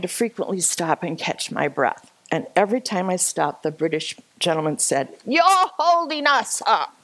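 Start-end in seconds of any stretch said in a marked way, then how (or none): 5.3-7.0 high-pitched imitation of a complainer; retold complaint. British gentleman to resting hiker, imitated complaint. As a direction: raise the pitch into mock indignation.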